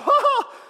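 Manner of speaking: loud, high mock gasp of shock; hammed-up startled cry on seeing an army. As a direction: gasp out loud in acted alarm. Servant beholds the army.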